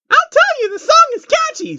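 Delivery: bubbly and thrilled about the song; excited Pinkie Pie. Acted. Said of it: "said an excited Pinkie Pie".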